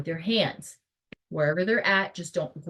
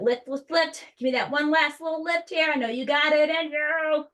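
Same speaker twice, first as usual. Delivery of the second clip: encouraging coach; 10 semitones higher, 4 dB louder than usual. Acted. Coaching final hard lift.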